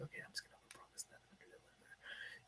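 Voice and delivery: unvoiced aside, the thing they won't say aloud; secret whisper. Shady broker's private thought about clients, whispered. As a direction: whisper it like a hidden confession. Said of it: "they don't tell you, they're like".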